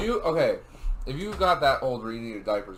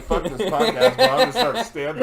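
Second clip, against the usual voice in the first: laughing mock outburst, raised pitch; acted outburst; 8 semitones higher, 6 dB louder than usual. Teller quoting the dude mid-laugh, mock outburst through laughter. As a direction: quote him loudly through laughter. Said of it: "Dude was like".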